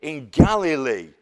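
a mocking put-down of Jesus of Nazareth; mocking sneer. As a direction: look down your nose at the man from up north. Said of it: "if they wanted to demean him".

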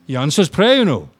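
loud joyful declaration; earnest joy. Retold or imitated. Dying man telling the preacher, loud and joyful. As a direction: proclaim it with conviction.

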